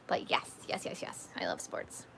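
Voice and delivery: breathy whisper, almost no voice; playful whisper. Acted. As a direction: drop to a secret whisper.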